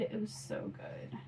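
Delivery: breathy, near-whispered praise of the bread; hushed delight. Real, not acted. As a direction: whisper it like a happy secret. Savoring remembered homemade bread.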